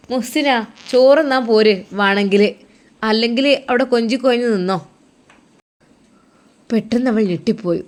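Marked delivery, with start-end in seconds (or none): 0.1-4.8 a louder, scolding call from downstairs; mother-in-law yelling up the stairs. Acted. Mother-in-law calls her daughter-in-law down to eat, loud and scolding. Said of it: "അതാ ഉമ്മ വിളിക്കുന്നു".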